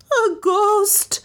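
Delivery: very high-pitched frightened cry; insistent wail. A narrator's reading. Maid answering the detective, frightened and insistent. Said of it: "persisted Lizzie".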